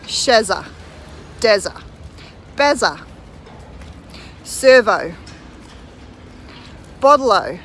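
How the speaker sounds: Aussie slang. Shown as a demonstration. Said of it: "here we go".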